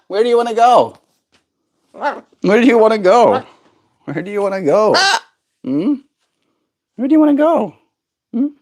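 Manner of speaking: high sing-song question. Real, not acted. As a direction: coo up high at the bird. Cooing to the bird.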